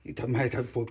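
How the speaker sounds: usual speaking voice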